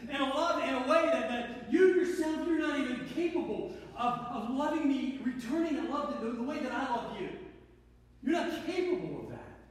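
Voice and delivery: earnest, lifted pleading of divine love; God speaking lovingly. Acted. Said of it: "He goes".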